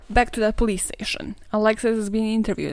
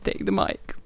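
whispered aside; unvoiced hushed repeat of "Take the mic"; 3 dB quieter than usual. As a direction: breathe it out as a playful whisper. Repeat after insult.

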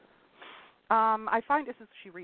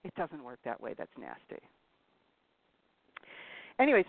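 normal talking voice

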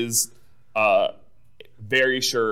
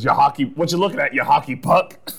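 gruff Potato Head; 4 semitones higher, 6 dB louder than usual. Retold or imitated. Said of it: "he says".

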